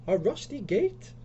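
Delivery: high, slow, indignant question; upset. Acted. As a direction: repeat it upset, voice rising at the end. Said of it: "Lisa's really upset now".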